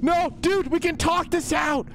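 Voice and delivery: high, frantic reasoning; high scared bargaining; panicked pleading. Real, not acted. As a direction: try to talk the monster down. Pleading with monster.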